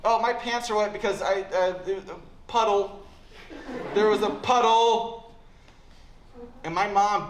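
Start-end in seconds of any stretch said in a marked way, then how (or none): 0.0-5.4 put-on louder, slow kid fibbing about a puddle; mock kid excuse. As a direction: sell the lame excuse with a laugh. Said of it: "I say".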